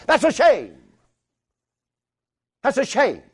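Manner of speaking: high, loud, near-shouting pulpit climax; preaching at full force. Acted. Preacher railing against phones breeding ignorance, shouting sermon. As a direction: thunder against the little machines.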